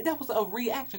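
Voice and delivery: usual speaking voice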